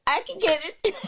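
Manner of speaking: quoted high and confident; bright confident quote; bold claim. Retold or imitated. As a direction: play back her bold line. Host quoting Jane's bold claim.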